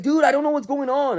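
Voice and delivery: high-pitched exasperated insistence; baffled protest. Retold or imitated. Worker insists to angry kid he's lost, baffled protest. Repeats his baffled reply.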